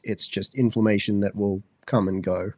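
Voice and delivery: ordinary talk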